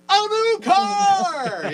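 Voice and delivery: high, slow announcer's boom; booming prize announcer. Acted. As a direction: stretch it out like a showman. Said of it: "I just always wanted to do that".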